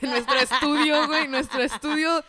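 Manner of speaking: laughing while talking. Real, not acted. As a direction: talk through a laugh. Mocking their own listener statistics.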